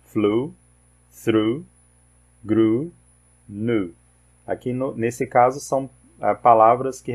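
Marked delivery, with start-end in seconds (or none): 0.0-4.0 "Flew", "grew", "knew" with a long U vowel; teacher modelling the EW in "through"; English EW as U. Shown as a demonstration. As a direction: model each word slowly, a long U vowel each time. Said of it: "todo E W tem som de U".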